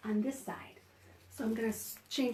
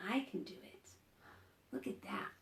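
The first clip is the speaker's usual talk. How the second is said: whisper; 11 dB quieter than usual. Real, not acted. Whispered aside.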